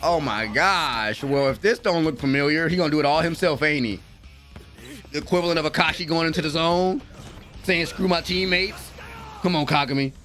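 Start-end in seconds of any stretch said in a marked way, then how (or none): none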